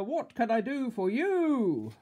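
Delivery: loud, slow, drawn-out shout; booming shopkeeper, high and loud; grocer's bellow. A narrator's reading. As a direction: bellow it, stretching the last word. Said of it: "bellowed the grocer".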